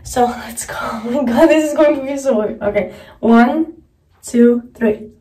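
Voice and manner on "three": brushing teeth while talking; toothbrush in mouth. Real, not acted. Seen 0: Toothbrush head held in open mouth throughout labelled words.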